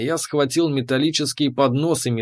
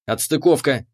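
ordinary delivery, nothing marked

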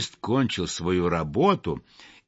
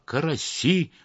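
usual speaking voice

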